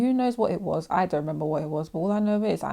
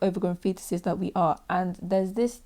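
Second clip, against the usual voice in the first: ordinary delivery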